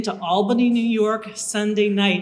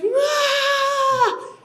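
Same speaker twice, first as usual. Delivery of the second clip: high, loud startled yelp; 4 dB louder than usual. Retold or imitated. Recruit yelping in fright at the guard's shout.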